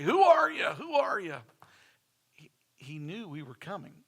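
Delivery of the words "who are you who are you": high, loud playful grandpa voice; mock-stern greeting, teasing the kids; grandfather teasing. Grandfather teasing grandkids at the back door, high playful voice. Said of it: "he'd say".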